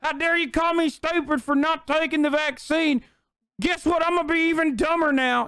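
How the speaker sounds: whiny caricature of an offended voter; mock someone acting wounded and defiant; dumb Republican. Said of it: "a dumb Republican who's like".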